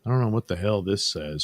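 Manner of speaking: normal talking voice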